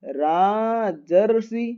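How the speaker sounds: Rajarshi read out very slowly, syllable by syllable; slow sounding out. Shown as a demonstration. Teacher slowly reading Rajarshi aloud to students. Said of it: "पढ़ के देखते हैं क्या होगा".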